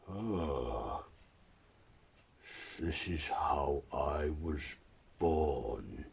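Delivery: slow, halting creature voice; slow monster working something out; creature's realisation. Acted. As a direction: drag the words out heavily, sinking at the end. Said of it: "As the creature reads on".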